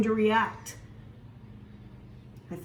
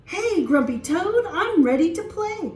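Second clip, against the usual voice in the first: eager greeting; 6 semitones higher, 3 dB louder than usual. Acted. Cat greets friend eagerly.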